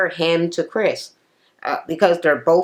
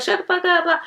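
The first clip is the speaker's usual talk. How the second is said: mock yelling; 13 semitones higher than usual. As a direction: imitate the table shouting in a high voice. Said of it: "So she's yelling".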